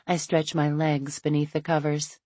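ordinary delivery

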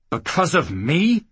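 incredulous rising question through gritted teeth; through clenched teeth. Acted. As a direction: grit your teeth and ask it. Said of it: "Jackal repeated through clenched teeth".

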